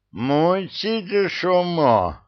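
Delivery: high and slow, through a mouthful; mouth full. Acted. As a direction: shout while chewing a bun. Man still chewing a bun.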